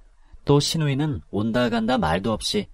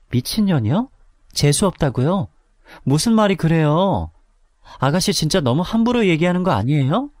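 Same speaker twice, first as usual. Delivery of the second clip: indignant protest; 6 semitones higher than usual. Acted. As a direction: stand up to her insults. Narrator protesting the insults. (억울한 항의)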